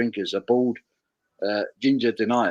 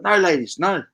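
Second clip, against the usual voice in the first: higher-pitched pretend refusal; mock protest; 6 semitones higher than usual. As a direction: fend off admirers in jest. Mock fending off admirers.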